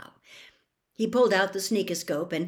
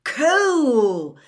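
high, loud, drawn-out cheer; thrilled; 7 semitones higher, 9 dB louder than usual. A narrator's reading. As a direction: whoop with delight at a gift. Delighted with new hat.

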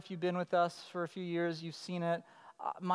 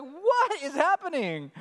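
higher, drawn-out, baffled question; mock exasperated dad; 6 semitones higher, 4 dB louder than usual. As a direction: play the bewildered father answering a yell. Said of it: "I'm just like".

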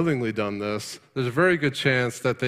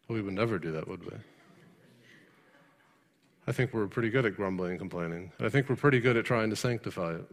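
much softer, low, knowing; wry ironic aside; 4 semitones lower, 7 dB quieter, faster than usual. Acted. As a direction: let them in on the joke quietly.

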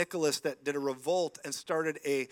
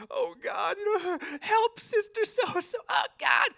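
very high, wailing mock plea, getting louder; hammed-up prayer drama; 5 dB louder, slower than usual. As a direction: parody a hysterical intercessor. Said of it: "Demons cling to that. They love that".

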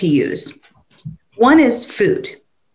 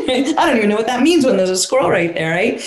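laughing; 3 semitones higher than usual. Real, not acted. Laughing at dog's imagined excuse.

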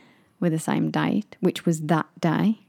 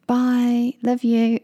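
imitating a higher, louder, blurted goodbye; chirpy goodbye; 6 semitones higher, 4 dB louder, much slower than usual.

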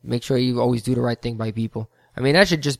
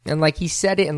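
ordinary talk

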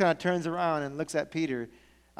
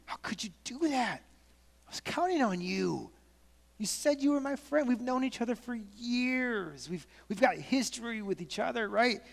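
hurt angry reproach; 4 semitones higher, 7 dB quieter than usual. Retold or imitated. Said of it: "I'd be angry".

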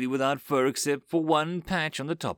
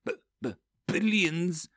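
stuttering b-, b-, billions, higher; stammering in shock; 6 semitones higher than usual. A narrator's reading. Narrator stunned by the creature's age, stammering in disbelief. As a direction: stammer it out dumbstruck. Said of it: "I stammer like an idiot".

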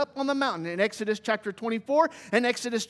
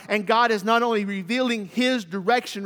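ordinary talk

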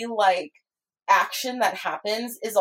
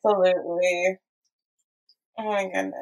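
usual speaking voice

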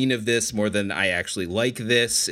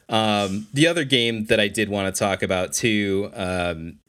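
ordinary talk

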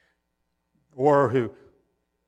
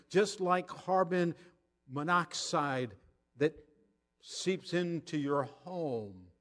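ominous quiet; 3 semitones higher, 6 dB quieter than usual. Real, not acted. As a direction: draw them in, softly. Ominous warning, dropping voice.

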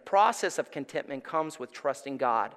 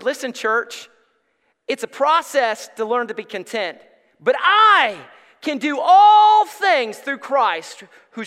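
high, loud, slow preacher's shout building to the verse; rising revival-preacher cry on Christ who strengthens me; preaching at full force; 7 semitones higher, 10 dB louder, slower than usual. Acted. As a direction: lift the church up with the promise.

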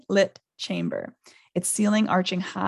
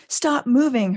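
ordering; 5 semitones higher, 5 dB louder, slower than usual. A narrator's reading. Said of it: "Hermione ordered them".

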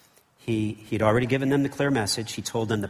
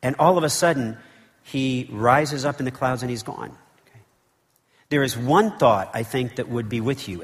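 usual speaking voice

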